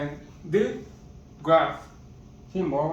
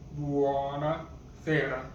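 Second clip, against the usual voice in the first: buona sera said slower, syllables spaced; slower repeat; slower than usual. Shown as a demonstration. Teacher modelling buona sera for learners, slowly. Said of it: "good evening is buona sera".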